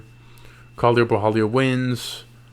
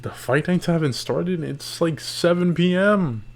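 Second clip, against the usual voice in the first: surprised; 6 semitones higher than usual. Retold or imitated. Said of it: "I'm like".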